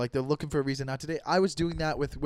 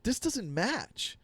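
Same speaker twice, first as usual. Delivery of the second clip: slow disbelief; 5 semitones higher, 5 dB quieter, much slower than usual. Retold or imitated. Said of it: "I was like".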